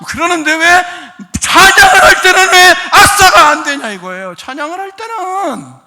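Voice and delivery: loud, high exhortation; rousing preaching. Real, not acted. Preacher urging people to jump and cheer in praise, shouting. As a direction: whip the congregation up with full voice.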